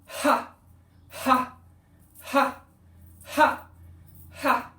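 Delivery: short loud bursts of "ha", pitched high; forceful ha exhales. Shown as a demonstration. Demonstrating the forward move of Hara breathing, forceful ha. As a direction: drive each breath out on a punchy ha. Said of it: "And then forward is".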